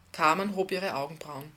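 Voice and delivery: plain reading voice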